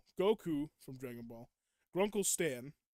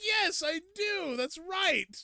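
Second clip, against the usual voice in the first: high, loud, drawn-out triumph; hammed-up victorious reply; proud mock shout; 8 dB louder, much slower than usual. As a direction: crow it with exaggerated pride. Imagined proud reply to fans.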